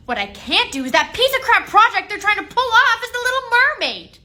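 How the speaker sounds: high, loud indignation; worked-up complaint; exasperated rant. Acted. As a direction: rant it with heated disgust. Teacher rants about the film.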